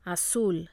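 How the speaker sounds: "Azul" with the weight on ZUL; stress on last syllable. Shown as a demonstration. Teacher models the rule for repeating, last-syllable stress. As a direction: punch the second syllable of "Azul". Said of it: "you must stress the last syllable".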